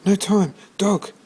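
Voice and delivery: put-on high, loud hurried boy; boy too rushed to explain, high and urgent; urgent George. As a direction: blurt it in a rush. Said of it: "said George".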